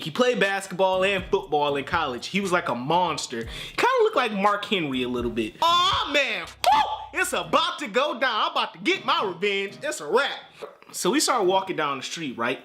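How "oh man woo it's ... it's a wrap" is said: high whooping excitement; hyped. Retold or imitated. Boy about to get his revenge, high whooping hype. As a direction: cheer like the win is certain.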